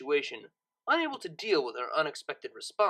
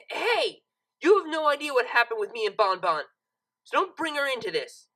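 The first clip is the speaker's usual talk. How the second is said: higher, louder angry protest; stung, pushing back hard; angry retort; 7 semitones higher, 5 dB louder than usual. A narrator's reading. Lyra angrily telling Octavia to leave Bon Bon out.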